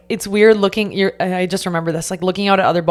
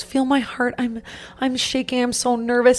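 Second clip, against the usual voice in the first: hushed, higher-pitched nervous quote; imitated nervous shaking; 4 semitones higher, 6 dB quieter than usual. Fiancée quoting her nervous partner, soft and shaky. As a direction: softly imitate someone shaking with nerves. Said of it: "He was shaking like this".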